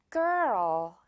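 put-on girl as two beats, R then L. Shown as a demonstration. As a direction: stretch girl into two syllables. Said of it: "약간 두 글자라고". (girl을 두 글자처럼)

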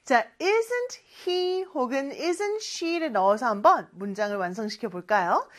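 usual speaking voice

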